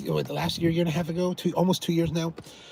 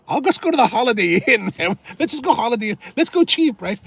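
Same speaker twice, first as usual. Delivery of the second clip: higher, louder, pushing to go cheap; eager pleading; 8 semitones higher, 4 dB louder than usual. Retold or imitated. To his cousin, urging the cheap hotel, rising excitedly. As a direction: talk him into the budget option, pitch climbing.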